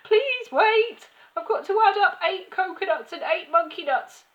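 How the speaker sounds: high, loud schoolboy squeal; desperate high-pitched protest; flustered cry. A narrator's reading. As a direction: plead loudly in a panicked boy's voice. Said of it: "he cried".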